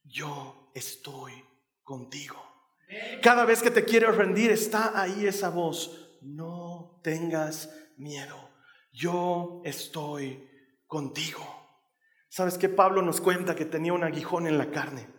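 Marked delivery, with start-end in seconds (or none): 0.0-2.3 very soft, slow and low; gentle murmur. Acted. Christ's voice comforting a frightened believer, a slow hushed murmur. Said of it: "es un suave murmullo que te dice". 6.2-11.5 very soft, slow and low; gentle murmur. Acted. Christ's voice comforting a frightened believer, a slow hushed murmur. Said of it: "suave murmullo".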